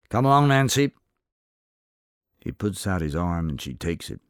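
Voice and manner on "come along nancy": a loud, gruff summons, pitched higher. A narrator's reading. Older gentleman ordering Nancy out of the saloon, gruff and loud. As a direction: bark it out as a stern old gentleman. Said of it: "says gruffly".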